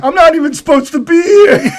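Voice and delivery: mock scream. Quoting a movie character to a friend, mock screaming. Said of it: "Just starts screaming".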